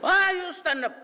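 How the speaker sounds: very high mock-furious scolding voice; shrill angry professor. Professor telling Duterte to sit down, shrill anger. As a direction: scold in a piercing high pitch. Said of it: "siya, shrill".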